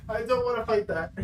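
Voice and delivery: quiet refusal. Real, not acted. Streamer refusing to face a monster, quietly. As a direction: say it softly, backing away from the mic.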